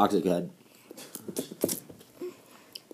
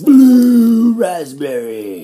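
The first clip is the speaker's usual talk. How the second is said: drawn-out silly shout; 15 dB louder, much slower than usual. Acted.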